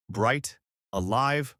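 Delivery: plain reading voice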